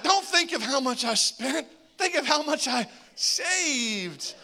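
high, slow pleading; husband pleading his excuse to his wife, high and slow. Retold or imitated.

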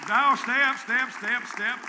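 shouted high and loud, easing off; rally the room to stay standing; calling out. Real, not acted. Keeping veterans standing, calling out.

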